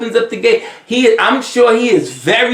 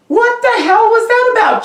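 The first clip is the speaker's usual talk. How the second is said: sudden high outburst of disbelief; outraged disbelief; 13 semitones higher than usual. Real, not acted. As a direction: pitch jumps up in shock, what the hell. Outraged reaction to the bitterness.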